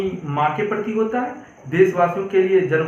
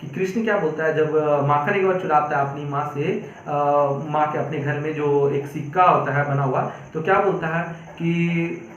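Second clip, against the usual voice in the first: ordinary talk, nothing marked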